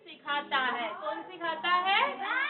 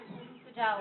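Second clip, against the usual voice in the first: ordinary talk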